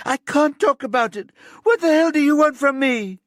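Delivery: very high, loud desperate yelling; hysterical shout. A narrator's reading. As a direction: scream it, losing control. Girl breaking down under questioning.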